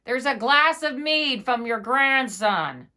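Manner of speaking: loud, slow, spelled-out yell; slow loud shout. A narrator's reading. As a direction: bellow each word at her. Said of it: "the innkeeper shouted his loudest".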